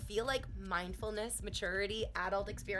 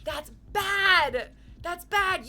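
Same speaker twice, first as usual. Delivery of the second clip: high, loud and slow mock whine; high, loud teasing that's bad; mock scolding; 7 semitones higher, 11 dB louder, much slower than usual. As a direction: scold Ian in play.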